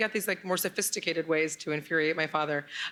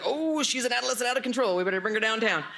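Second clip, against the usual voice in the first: higher, exaggerated officer alarm; mock police; 6 semitones higher, 3 dB louder than usual. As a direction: mimic a scandalized cop, pitched up and loud. Mimics police who stop her.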